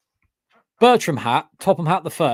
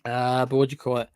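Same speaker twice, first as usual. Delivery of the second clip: normal talking voice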